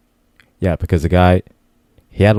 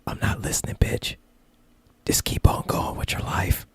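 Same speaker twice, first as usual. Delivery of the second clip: voiceless whisper as the smart speaker; whispered reply; 10 dB quieter than usual. Acted. Smart speaker brushing off its eavesdropping owner, whispered. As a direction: whisper it back dismissively. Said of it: "sometimes I'll whisper".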